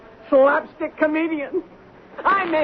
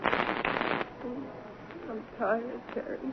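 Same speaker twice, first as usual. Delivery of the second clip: weary murmur; 3 semitones lower, 15 dB quieter, much slower than usual. Acted. Exhausted drunk actress gives up.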